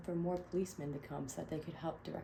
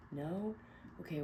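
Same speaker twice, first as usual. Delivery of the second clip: ordinary delivery, nothing marked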